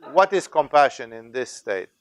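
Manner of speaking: ordinary talk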